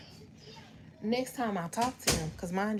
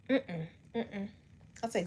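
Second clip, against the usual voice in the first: ordinary talk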